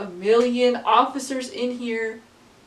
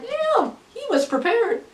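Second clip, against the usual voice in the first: high, drawn-out mock awe; impressed surprise; 11 semitones higher than usual. Teen retelling her reaction to shooter's attorney, mock awe. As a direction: act amazed he came so ready. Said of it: "I was like".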